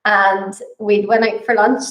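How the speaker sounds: ordinary delivery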